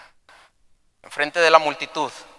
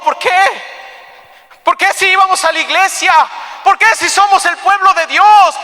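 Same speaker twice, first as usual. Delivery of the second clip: wife's anguished plea; 14 semitones higher, 7 dB louder than usual. Acted. Achan's wife demanding answers from her husband, anguished. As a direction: build to a shouted, desperate why. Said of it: "La esposa de Acán diciéndole".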